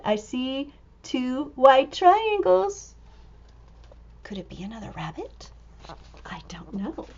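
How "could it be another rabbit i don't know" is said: fast hushed whisper, much softer. Acted. Reader to children, secret whisper. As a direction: keep them guessing in a whisper.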